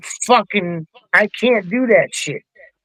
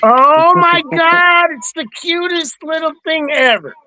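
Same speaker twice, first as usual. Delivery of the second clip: gushing; 6 semitones higher than usual. Real, not acted. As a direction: coo like it's adorable. Gushing over cute thing.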